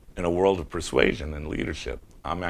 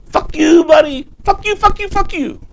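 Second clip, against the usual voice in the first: screaming mock rage, much higher and louder; furious shout; 14 dB louder than usual. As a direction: hurl it at the arresting officer. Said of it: "And I go".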